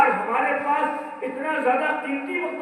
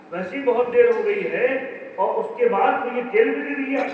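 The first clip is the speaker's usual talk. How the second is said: usual speaking voice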